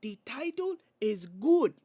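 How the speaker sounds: put-on slow, high Spanish-accented promo voice; imitated wrestler, Spanish accent, punching the end; Penta promo impression. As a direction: draw it out loud in a mock Spanish accent. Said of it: "But he was".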